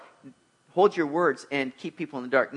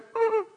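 very high mock-frightened whimper; little squeal of pretend panic; freaking-out noise, small and high; panicked noise; 4 dB quieter than usual. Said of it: "you know, freaking out".

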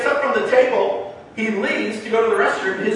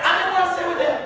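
usual speaking voice

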